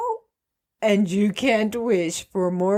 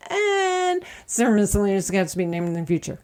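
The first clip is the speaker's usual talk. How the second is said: fast fine print; much faster than usual. Acted. Rushed fine print read.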